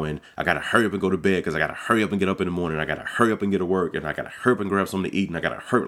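fast, hurried run of words; breathless rush through the day. Acted. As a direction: rattle it off like you're late. Mimicking the rushed daily routine.

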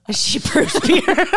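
put-on high, loud, slow old-lady voice; old lady smoker. Host adds to her diner character's story, old lady smoker voice. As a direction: draw it out as a hammed-up old smoker. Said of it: "my old lady who smokes a lot".

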